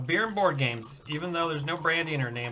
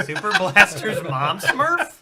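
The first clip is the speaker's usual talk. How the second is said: laughing question; 4 semitones higher, 8 dB louder than usual. Real, not acted.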